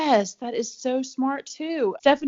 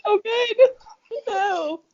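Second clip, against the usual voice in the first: laughing; 13 semitones higher, 6 dB louder, slower than usual. Real, not acted. Host reacting to the funny story.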